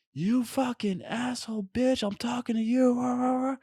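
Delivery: strained mock shout dissolving into rah-rah noises; mock yelling. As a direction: imitate a stranger's tirade at held-back volume. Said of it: "he just kept on like".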